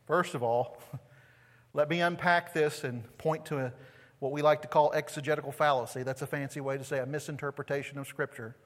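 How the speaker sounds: ordinary delivery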